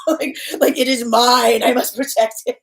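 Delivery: loud, hammed-up possessiveness with a laugh; possessive Scorpio. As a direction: imitate a fiercely protective Scorpio. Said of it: "once with a Scorpio".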